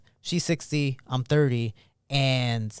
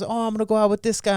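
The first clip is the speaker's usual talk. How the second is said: higher-pitched, airy mom impression; imitated mother; 8 semitones higher, 4 dB louder than usual. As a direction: play mom announcing her date, pitched up. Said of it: "She goes".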